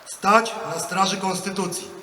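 loud, measured oath line; formal oath dictation. Real, not acted. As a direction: declaim each phrase for the ranks.

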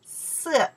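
色 starting high and falling sharply; isolated 色 with a quick falling tone; fourth tone. Shown as a demonstration. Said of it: "The four tones".

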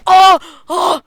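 sudden high startled cry; startled scream. Real, not acted. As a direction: jump out of your skin. Jumpscare in horror game.

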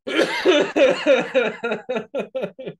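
long, high, loud cackle; loud laughing fit. Real, not acted. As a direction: burst out laughing at your own story. Laughing at own screaming story.

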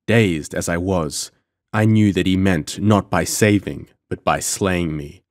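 usual speaking voice

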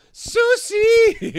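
"Sushi" with s in place of sh, high and loud; mock accented sushi. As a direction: cry "Sushi" out as "soo-see". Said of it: "not say the S H".

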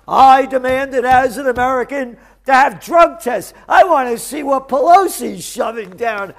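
loud, high shouting tirade; angry rant. Real, not acted. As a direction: yell your outrage at Congress. Ranting demand at Congress.

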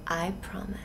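hushed, solemn whisper; whispered vow. Acted. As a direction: breathe the promise out, barely voiced.

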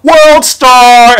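loud shouted call from the crowd; retold yell. Classmate yelling out as the fight starts, loud shout. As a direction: bellow it out like a kid hyping a fight. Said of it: "I hear someone yell out".

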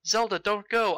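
ordinary talk, nothing marked

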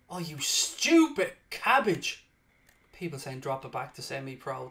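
ordinary talk

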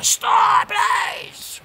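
high, wailing fake plea; mock pleading shriek. Begging for the noise to stop, mock pleading shriek. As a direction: beg loudly in a squealed wail.

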